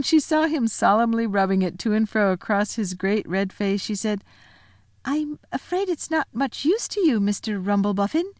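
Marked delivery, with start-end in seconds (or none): 5.0-8.3 put-on Lucy's high girl voice. Lucy to giant.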